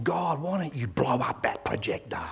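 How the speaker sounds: hushed unvoiced plea; a whispered angry prayer to God. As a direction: whisper it like a secret prayer in bed.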